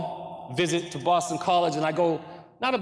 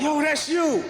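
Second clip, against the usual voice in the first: excited friends yelling; 9 semitones higher, slower than usual. Retold or imitated. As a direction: shout it at him, thrilled. Friends shouting at him.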